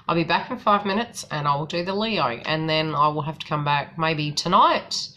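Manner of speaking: ordinary talk, nothing marked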